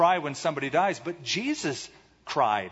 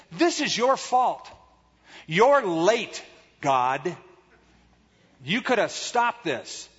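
high-pitched, emphatic blame; acted reproach, pushing each accusation; accusing; 5 semitones higher, 4 dB louder, slower than usual. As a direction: throw the blame at the latecomer. Voices Martha's accusation of Jesus.

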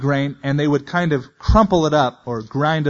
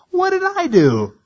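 put-on an indignant kid sibling objecting, much higher; arguing little brother; 6 semitones higher, 4 dB louder than usual. Younger brother answering Eliab's scolding with indignant protest. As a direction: pitch jumps way up on a protesting what did I do. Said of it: "It sounds like siblings arguing".

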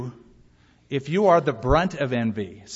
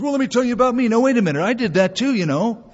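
high, loud, pushy interrupter; mock braggart; 8 semitones higher, 5 dB louder, faster than usual. Braggart breaking into talk about somebody else, mock self-important. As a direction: butt in as a hammed-up show-off. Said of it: "when you brag, you just can't stand it".

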